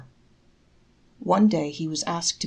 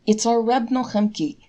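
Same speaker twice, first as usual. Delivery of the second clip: apologetic; 5 semitones higher, 5 dB louder than usual. A narrator's reading. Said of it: "he told him apologetically".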